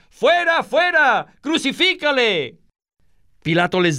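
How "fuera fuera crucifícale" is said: very high-pitched mob cry; crowd shouting. A narrator's reading. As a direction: shout for him to be taken away. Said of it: "Pero ellos gritaron". (gritos muy agudos de la turba)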